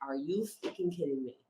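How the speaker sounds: low, soft retold exasperation; quiet, incredulous aside; muted disbelief. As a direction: say it under your breath in disbelief. Retold disbelief at tired hiker.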